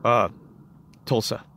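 imitating a curt, low-key answerer; brief reply. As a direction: give a mock minimal answer. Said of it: "real brief about it, quick".

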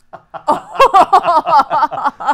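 loud, high burst of laughter; real helpless cackling; big laugh. Real, not acted. Laughing at the retold insult.

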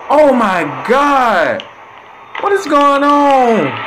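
high, slow, stretched-out alarm; stunned viewer asking what is happening; shocked disbelief. Real, not acted. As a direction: drag it out in shock. Shocked reaction to scene.

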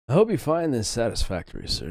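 high, loud grovelling maître d'; grovelling waiter. Acted. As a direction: fawn over the guest with an open palm. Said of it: "grovels the maitre d'".

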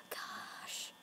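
a tiny scared whisper; whispered gosh. Real, not acted. Gamer whispering while watching cameras. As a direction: breathe it out in fear.

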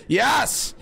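high triumphant yes; excited. Real, not acted. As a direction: cheer it out. Triumph finding drawer.

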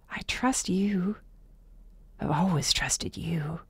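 slow, soft, breathy confession; hushed and sincere; heartfelt reassurance. A narrator's reading. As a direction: say it gently, letting each word settle. She reassures him, softly and slowly.

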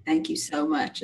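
low, soft, sincere gratitude; heartfelt thanks. Acted. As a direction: say it gently, touched and grateful. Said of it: "like, heartfelt thanks".

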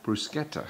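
"Bruschetta" echoed softly with a hard k; Italian pronunciation. Retold or imitated. Husband echoing his co-host's Italian word, "Bruschetta" with a soft-voiced hard k. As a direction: softly try "Bruschetta" as brus-KET-ta. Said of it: "they actually pronounce it bruschetta".

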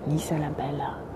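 softly whispered aside. Acted. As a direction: whisper the city's nickname fondly. Fond aside about her city.

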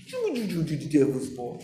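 slow, very soft and low cooing; Jesus, mock gentle. Shown as a demonstration. Preacher parodying Jesus rebuking devils lovingly, mock gentle. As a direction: stretch the rebuke into a hushed endearment. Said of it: "Was He doing that".

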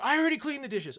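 high, loud mock scolding; imitating angry mom. Mom scolding her kid over the dishes, mock yell. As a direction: play the fed-up mom yelling. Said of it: "Your mom's screaming at you".

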